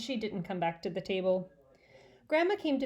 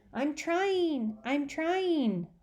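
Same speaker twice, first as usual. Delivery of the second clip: put-on high birdlike echo of the boy; bird repeating; 7 semitones higher, much slower than usual. Mynah bird parroting Fudge's holler. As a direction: parrot the words back, squawky. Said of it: "Uncle Feather repeated".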